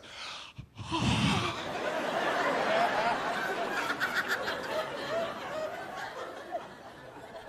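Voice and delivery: mock excited gasp. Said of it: "She's like".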